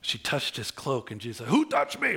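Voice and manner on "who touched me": sudden high outburst in a retold story; acted cry of a startled question; startled demand.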